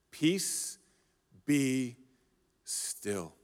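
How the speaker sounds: quiet, weighty authority; calm command. Acted. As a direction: still the storm with a hush. Jesus calms the storm.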